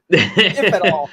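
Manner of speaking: slow, giggling afterthought; laughing. Real, not acted. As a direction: laugh through the last words. Laughing aside.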